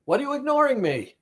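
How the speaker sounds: indignant, high-pitched and quick; ignored inner voice. Acted. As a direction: demand attention like someone being ignored. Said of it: "that's how the voice feels".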